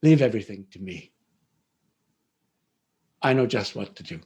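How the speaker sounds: low, calm, reassuring; kindly. Acted. Princess's wife calms the weeping mother, low and kind. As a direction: soothe her, low and certain. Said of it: "looked at her kindly and said".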